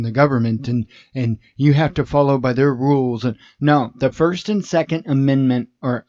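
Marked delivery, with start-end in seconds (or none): none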